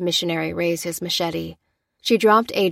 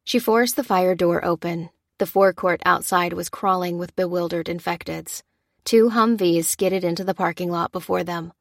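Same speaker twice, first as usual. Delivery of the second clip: ordinary delivery, nothing marked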